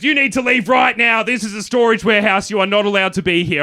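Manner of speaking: loud, high, stern scolding; hammed-up irate warehouse worker; angry ordering. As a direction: order the intruder out forcefully. Warehouse man orders him out.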